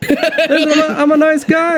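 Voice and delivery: high brash cockney captain voice; cockney pirate captain. Acted. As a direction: bark it like a cocky pirate boss.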